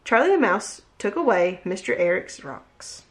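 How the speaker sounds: plain reading voice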